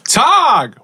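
high shouted call. Acted. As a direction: call his name across the woods. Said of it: "start calling after him".

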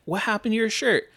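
surprised woman. Retold or imitated. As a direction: ask it high and startled. Dolores quoted.

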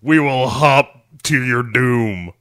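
slow, hammed-up doom threat; lightly voiced band frontman, slowly; mock doom band. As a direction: draw it out as mock menace.

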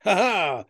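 short put-on laugh; imitated laugh. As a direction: imitate someone laughing at a friend. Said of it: "Bonzo started laughing because he's like".

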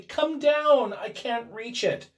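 high, strained urgent call; loud cry. A narrator's reading. Wife unable to reach the bolt, crying to her husband. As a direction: call for help, high and desperate. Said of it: "she cried loudly".

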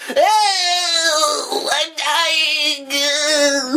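very high, drawn-out cry; hammed-up high death howl, every vowel stretched; mock dying wail. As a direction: wail melodramatically as if perishing.

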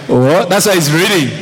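ordinary talk

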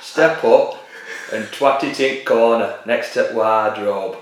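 hammed-up Barnsley accent giving orders. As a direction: bark orders like a Yorkshire captain. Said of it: "you just did him from Barnsley".

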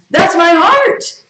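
high, drawn-out loud mock cry; exclaimed confession. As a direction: stretch it out loud and high, hamming it up. Acts out confessing a sinful heart.

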